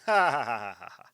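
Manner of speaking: mocking villain laugh, big then dropping; booming demon lord. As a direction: gloat like a cartoon villain. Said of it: "the flu said".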